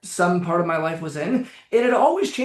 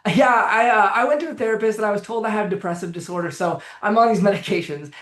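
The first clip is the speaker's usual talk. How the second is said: cheerful; 2 semitones higher than usual. Retold or imitated. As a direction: toss it off brightly, like it's nothing. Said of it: "It was like this".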